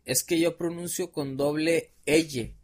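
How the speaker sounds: slow, "L" said "eye" instead of "ele"; the slip lands on "L"; mispronounced L. Retold or imitated. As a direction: imitate him saying "L" with a Y sound. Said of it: "Como no pude decir doble L".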